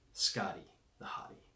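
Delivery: breathy stage whisper of his nickname. Pretend. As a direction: hush it like a secret. Husband introduces himself to viewers.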